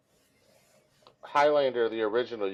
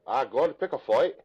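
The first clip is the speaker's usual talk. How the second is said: hammed-up, higher Scottish voice; badly faked Scottish accent; horrible Scottish accent; 4 semitones higher than usual. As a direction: put on a bad Scottish brogue. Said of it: "do a horrible Scottish accent".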